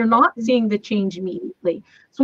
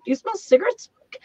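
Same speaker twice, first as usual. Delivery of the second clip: imitating the husband, higher, with puzzled surprise; puzzled husband asking; 9 semitones higher, 4 dB quieter than usual. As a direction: ask it higher, like a husband who can't believe what he smells. Husband quoted asking about smoke.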